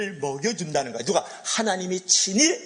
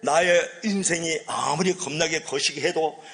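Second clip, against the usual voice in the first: slow, drawn-out Jeolla dialect; Jeolla accent; 2 semitones higher, 3 dB louder, slower than usual. Shown as a demonstration. Preacher giving the Jeolla version of the psalm, Jeolla accent. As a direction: ham up the psalm in Jeolla dialect, stretching the endings. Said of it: "전라도 버전을 한번 할게요".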